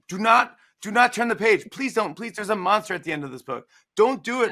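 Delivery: high, loud pleading Grover. Retold or imitated. Grover begging the reader not to turn, high pleading. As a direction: beg the reader like a panicked puppet. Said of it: "Grover's telling you".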